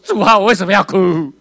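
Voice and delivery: acted shout up to God, high and very loud; loud cry. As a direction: cry out a desperate question in prayer. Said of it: "我就大声说".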